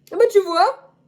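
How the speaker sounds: high, loud exclaimed; high loud mock exclamation; mock outburst.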